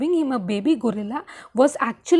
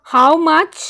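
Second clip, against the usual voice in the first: brutal interruption; 3 semitones higher, 9 dB louder than usual. Acted. Wife cutting her husband off with a loud, hard demand. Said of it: "Jackie interrupted brutally".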